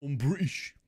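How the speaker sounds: mock British voice with a different timbre; playful put-on British villager; villager-style British voice. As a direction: say it like a Minecraft villager gone British. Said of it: "Just like the villagers from Minecraft".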